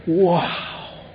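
high, hushed "Wow"; soft high-pitched amazed wow; awed wow. Pretend. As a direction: breathe it out in wonder.

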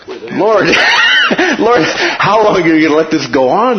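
loud, high cry of impatience to God; exasperated plea. Acted. Praying believer crying out to God, loud and impatient.

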